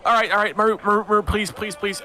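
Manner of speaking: high, hurried pleading; high-pitched calls for Maru to stop; half-orc intervening. Acted. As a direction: break up a quarrel with repeated pleas. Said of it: "you just hear from the distance".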